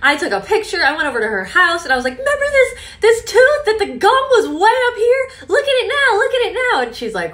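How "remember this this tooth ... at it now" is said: high and loud, bursting with excitement; excited showing off. Retold or imitated. To her mom, about her gum growing back: high excited amazement. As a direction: thrust the tooth at her, amazed.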